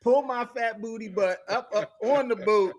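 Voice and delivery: loud, high, laughing through the words. Real, not acted. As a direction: laugh at yourself climbing aboard. Laughing about climbing onto boat.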